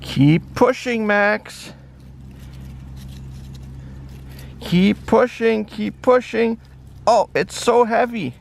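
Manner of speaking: high puppy voice, pushing with effort; high-voiced pup, mock strain; little dog straining. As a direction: squeak out the effort of shoving something heavy. Dog urging friend to push.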